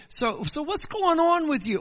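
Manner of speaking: a hint of a prying neighbor asking questions; nosy neighbor. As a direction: lean over the fence and pry. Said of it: "You get".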